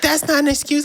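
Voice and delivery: mock yell. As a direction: imitate an angry manager's scolding. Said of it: "She's yelling. Like".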